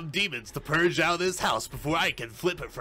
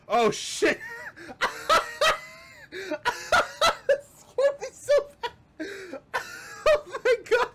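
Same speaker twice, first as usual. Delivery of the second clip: terrified laughing scream. Real, not acted. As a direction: shriek and laugh after a scare. Scared by monster.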